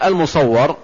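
المصور misread as al-musawwar, with an A before the final R; misreading المصور as the passive al-musawwar; mispronounced vowel. Shown as a demonstration. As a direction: say المصور with a fatha on the waw, "-wwar". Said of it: "فلو قال".

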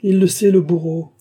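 high, loud cry of exasperation; outraged howl. A narrator's reading. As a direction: howl it in indignation. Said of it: "hurla la comtesse".

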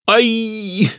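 high, drawn cry of pain; pained yelp. A narrator's reading. Hunter yelps as cognac cleans his scrape, pained yelp. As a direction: yelp as the sting hits. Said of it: "вскрикнул Матвейка".

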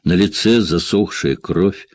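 ordinary delivery

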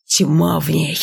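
ordinary delivery

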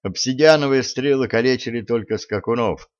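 ordinary delivery

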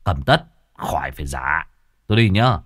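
low, rough-edged middle-aged man; Cậu Sáu's voice. Acted. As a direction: drop low as the wandering sorcerer. Cậu Sáu pays and leaves.

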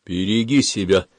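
ordinary delivery, nothing marked

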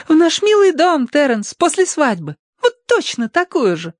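high, dreamy gushing; Tossie. A narrator's reading. Tossie to Terence about their future home, rapturous. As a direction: gush sweetly in a squeaky high voice. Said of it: "всплеснула руками Тосси".

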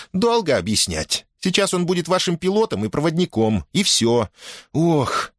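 put-on high-pitched, brisk girl's voice; impatient girl. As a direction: brush off the question impatiently. Girl brushes off question.

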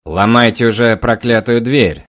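loud man's voice through a door; from the corridor. A narrator's reading. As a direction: shout from down the hallway. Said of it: "раздался из коридора громкий мужской голос".

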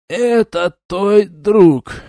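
high, very slow slurred mumble; tongue tangled with drink, dragging it out; slurred drunk. Acted. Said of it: "едва смог пролепетать заплетающимся языком".

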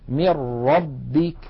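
من ربك recited slowly as "mir-rabbik", n swallowed by a doubled r; merging into ra. Shown as a demonstration. Teacher demonstrating the ra example, doubled r in من ربك. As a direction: go straight to a strong r in من ربك. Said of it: "والراء قد وضع عليها شدة وفتحة".

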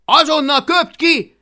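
loud, high-pitched yelled command; Hablaty howling. Acted. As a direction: bellow the order at full volume. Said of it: "üvöltötte Hablaty".

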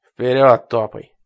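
loud, slow barked order; command. Acted. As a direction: order her forward harshly. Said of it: "скомандовал он".

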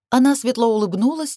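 ordinary delivery

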